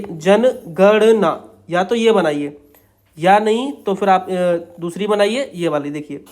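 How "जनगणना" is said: stretched very slowly, syllable by syllable; drawn out while writing. Shown as a demonstration. Teacher drawing out the word while writing its outline, slowly. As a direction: draw the word out as the pen moves. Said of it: "जनगणना ऐसे बनाइए".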